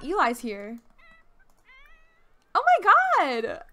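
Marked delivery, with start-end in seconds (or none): 2.5-3.6 much higher, loud gasp of surprise; real startled outburst; shocked. Real, not acted. Surprise at Eli arriving.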